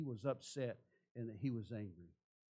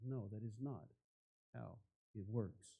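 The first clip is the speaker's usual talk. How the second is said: low, soft correction; quiet refusal; 4 semitones lower, 9 dB quieter than usual. Acted. God answering Cain's wrong offering, quiet refusal. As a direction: turn the offer down softly and firmly. Voices God's calm refusal to Cain.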